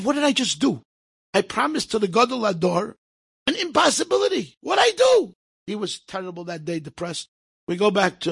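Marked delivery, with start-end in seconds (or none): 0.0-5.3 put-on distraught boy. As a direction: lament it as if close to tears. Boy crying after the promise.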